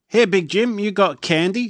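high, loud calling guard. A narrator's reading. Guard handing Fluffy a letter, high and loud. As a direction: sing it out like a cheery guard. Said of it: "said the guard".

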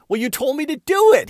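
high, loud mock-indignant comeback; incredulous retort. Teasing the friend who doubted, high-pitched and loud. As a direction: toss it back at him, amused.

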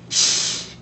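sharp unvoiced hiss; hissed shush. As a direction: hush them with a hiss. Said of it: "hissed Professor McGonagall".

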